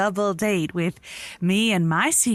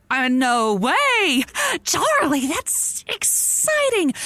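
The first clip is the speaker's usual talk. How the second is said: excited squeal; 7 semitones higher, slower than usual. Acted. Friend hears of secret man.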